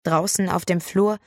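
usual speaking voice

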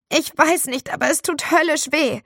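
high, loud hammed-up whining; milk the pain, a touch theatrical; faked pain. Boy keeps up the act for the teacher, high hammed whine.

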